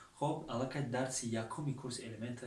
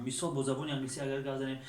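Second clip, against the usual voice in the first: normal talking voice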